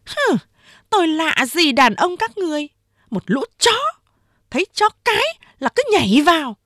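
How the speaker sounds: high, contemptuous female character; scornful insult. A narrator's reading. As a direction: spit out contempt, pitched well up. Hứa Yến insults the men.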